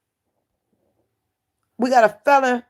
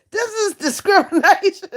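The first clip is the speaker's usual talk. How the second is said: mock outrage; 12 semitones higher than usual. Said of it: "I was outraged".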